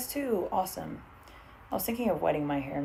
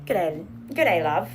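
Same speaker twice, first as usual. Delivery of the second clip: put-on Australian accent; slower than usual. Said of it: "break out in this accent".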